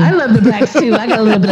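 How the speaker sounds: mock Trump voice. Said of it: "joining the Trump mock".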